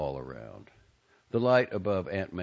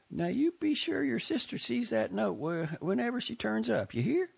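higher, motherly Southern aunt; fond aunt's farewell. A narrator's reading. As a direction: send him off, pitched up and fond. Aunt Maisie voiced by reader.

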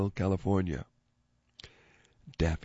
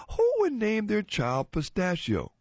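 high incredulous mother; 11 semitones higher than usual. Acted. Mother voiced in joke.

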